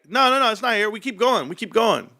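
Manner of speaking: put-on high eager kid voice. Kids urging cops on, high eager voice. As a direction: push them onward like a sure kid.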